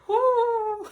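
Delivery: a high, loud release of feeling; excited whoop. Real, not acted. After helping the old man, loud whoop. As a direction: let the emotion burst out in one cry.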